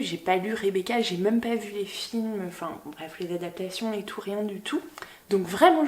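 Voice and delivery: normal talking voice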